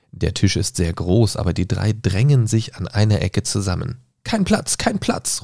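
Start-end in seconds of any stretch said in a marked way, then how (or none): 4.2-5.4 high, insistent cry; calling out. Acted. Hatters call to arriving Alice, high insistent cry. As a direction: wave her off from the table. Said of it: "rufen sie".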